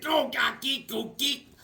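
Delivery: high, choppy gibberish squawks; cartoon nonsense noises. Drawn guy answering in gibberish, high comic noises. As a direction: jab out high nonsense syllables one by one.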